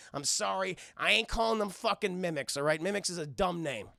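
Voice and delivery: ordinary talk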